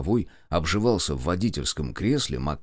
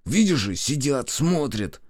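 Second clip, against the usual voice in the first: higher, urgent hissed warning; 6 semitones higher, slower than usual. A narrator's reading. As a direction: warn a friend they are being watched. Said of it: "прошипел он". (шипя, предостерегающе)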